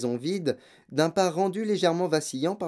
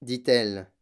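ordinary delivery, nothing marked

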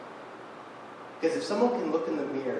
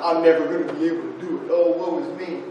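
mock self-pity; 6 dB louder than usual.

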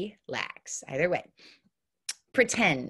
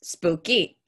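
normal talking voice

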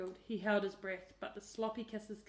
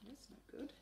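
whisper; 5 semitones higher, 11 dB quieter than usual. A narrator's reading. Narrator aside.

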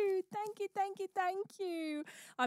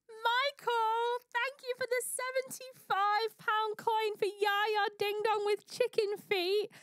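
ordinary talk, nothing marked

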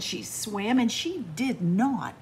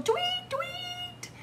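high piping whistle imitation; whistle tweet. A narrator's reading. Narrator imitating the coach's whistle, high and piping.

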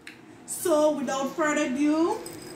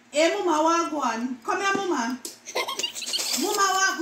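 calling out in patois; Jamaican patois; 3 semitones higher than usual. Shown as a demonstration. Vlogger calling to mama in patois. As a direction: call to mama Jamaican style. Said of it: "words that Jamaican mispronounce".

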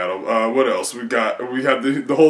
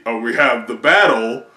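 usual speaking voice

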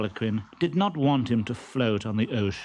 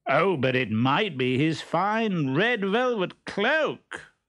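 loud Harlequin; 6 semitones higher, slower than usual. Acted. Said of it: "said Harlequin loudly".

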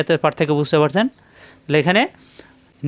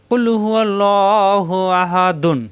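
Quran recitation; 3 semitones higher, much slower than usual. Shown as a demonstration. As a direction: recite slowly, drawing out each vowel. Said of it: "কেন ধরতে হবে?".

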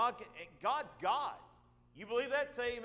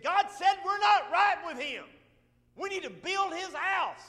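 preacher's cry; 9 semitones higher, 8 dB louder than usual. Acted. Preacher voicing Haggai.

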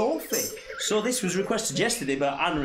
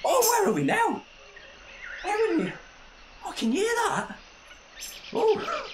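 much higher storyteller wonder; wide-eyed excitement for children; playful wonder; 13 semitones higher than usual. Acted. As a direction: marvel at the new place for kids. Arriving at new scene.

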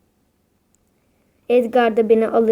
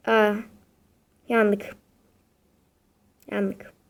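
ordinary talk

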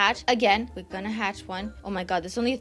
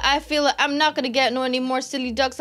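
ordinary delivery

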